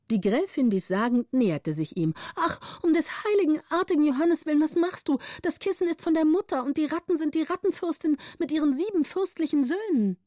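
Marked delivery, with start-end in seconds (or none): 2.3-10.2 put-on high girl's voice, rushed, anxious pleading; a small girl begging to save her rats; pleading little girl. A narrator's reading. Gritta pleading with the countess. (hohe, flehende Mädchenstimme)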